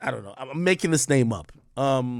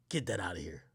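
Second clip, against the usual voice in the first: whispered dismissal; 7 semitones lower, 12 dB quieter than usual. Real, not acted.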